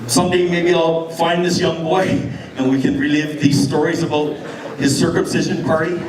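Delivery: ordinary talk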